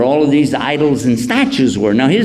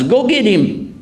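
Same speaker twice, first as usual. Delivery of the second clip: higher, mock angry order; angry crowd; 10 semitones higher, slower than usual. Townspeople ordering the boy brought, mock angry. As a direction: send for the boy. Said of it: "townspeople order".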